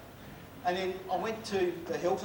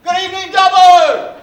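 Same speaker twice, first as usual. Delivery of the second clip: drawn-out roaring hello to the crowd; big shouted greeting; 13 semitones higher, 23 dB louder, slower than usual. Real, not acted. Comedian greeting the Dubbo crowd with a long roaring shout. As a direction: bellow it to the back row, stretching Dubbo.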